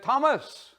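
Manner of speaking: casual mum's call from the door. Retold or imitated. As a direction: call the boy's name from the doorstep, not yet angry. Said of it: "and she said".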